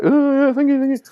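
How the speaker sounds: very high, strained, flustered thanks. Retold or imitated. Writer thanking the actor while freaking out, squeaky. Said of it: "I just was like".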